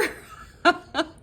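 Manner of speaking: short high burst of laughter; amused giggle. Real, not acted. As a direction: laugh at your own pun. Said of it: "laughs at own pun".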